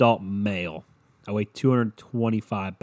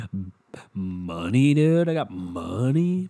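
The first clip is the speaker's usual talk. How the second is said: mock bragging; 2 semitones lower, 4 dB louder, slower than usual. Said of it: "Nah, I'm fucking with you".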